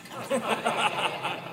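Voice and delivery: a high, soft, surprised laugh; a short, amazed chuckle. Real, not acted. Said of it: "Where did that come from?".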